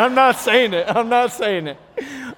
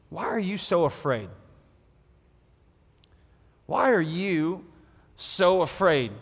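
gentle question; 5 semitones lower, 7 dB quieter than usual. Retold or imitated. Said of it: "then he asked them".

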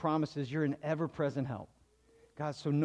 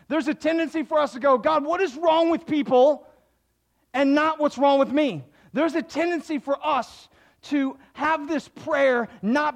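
louder, higher, driving sermon delivery; full-force preaching; 9 semitones higher, 8 dB louder than usual. Acted. Pastor urging the congregation to pray personally, loud and fervent. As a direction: push it out like a pastor building the point.

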